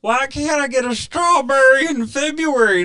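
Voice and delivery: very high, loud whining customer; put-on squeaky voice of an entitled shopper; whiny mock complaint. Shopper moaning about out-of-season strawberries, mock whining.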